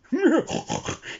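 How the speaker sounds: high mocking giggle. A narrator's reading. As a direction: giggle behind a hoof. Animals laughing at Dizzy.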